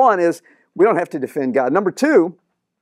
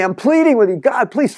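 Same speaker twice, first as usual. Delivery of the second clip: high, desperate pleading to God; a man imploring God three times over; 7 semitones higher than usual. Retold or imitated. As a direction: beg God, pitch climbing up. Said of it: "Three times he pleaded with the Lord".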